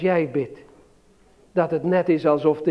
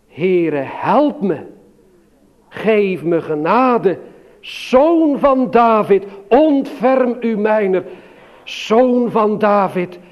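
crying out harder; 3 semitones higher, 3 dB louder, slower than usual. Retold or imitated. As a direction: beg louder and louder for mercy. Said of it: "nog harder begon te roepen". (Bartimaeus smeekt steeds luider om genade)

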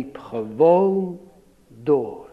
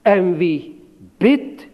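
slow recited verse; slower than usual. Shown as a demonstration. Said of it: "Dat moet je maar goed vasthouden".

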